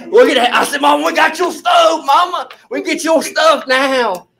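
high, loud, triumphant shout to Mom; excited calling out. Retold or imitated. Son tells Mom about her new stove, excited calling out. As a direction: whoop the good news, re-enacted.

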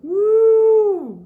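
high loud siren wail; siren woo. Acted. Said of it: "went a siren".

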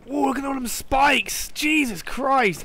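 high amazed exclaim; wide-eyed surprise at spikes; startled whoa. Real, not acted. Sees spikes in game.

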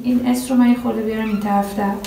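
normal talking voice

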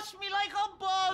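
high, panicky wail; terrified. Acted. Cowering accountant fears the man will jump on him, terrified. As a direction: wail as if about to be attacked.

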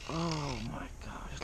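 breathless whispered wonder; awed whisper. Real, not acted. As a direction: whisper it in awe. Landing a big trout.

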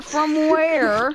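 high, loud startled yelling; surprised shout. Real, not acted. Surprise attack in game.

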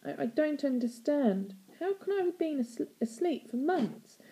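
put-on bewildered girl, faltering; voice cracking. A narrator's reading. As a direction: stumble over it in bewilderment, voice rising. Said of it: "Stella's voice cracked as she asked".

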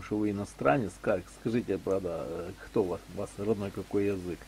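ordinary talk

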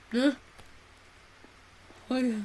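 usual speaking voice

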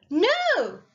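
high, loud cry of refusal; shocked protest. A narrator's reading. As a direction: cry out against the story's turn. Abilene protests the shooting.

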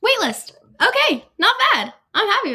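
high, loud relieved approval; pleased it wasn't a rejection; happily surprised. Real, not acted. Reacting to UPenn waitlist.

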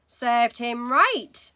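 slow, loud smug verdict; wife's voice. Acted. Mother judging boy with stuck finger, slow and loud. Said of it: "Missus Wormwood said".

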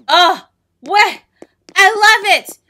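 loud, high burst of delight; delighted shout. Real, not acted. Gamer seeing her new floor, delighted shout. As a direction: shout with amazed joy.

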